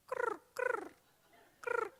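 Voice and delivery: high chirping cricket noise; mock cricket chirps. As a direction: imitate a lone cricket in silence. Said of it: "one lonely cricket goes".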